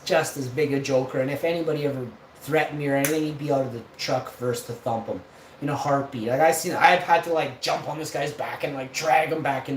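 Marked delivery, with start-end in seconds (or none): none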